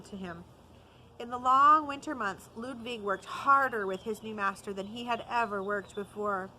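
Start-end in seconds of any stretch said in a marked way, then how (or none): none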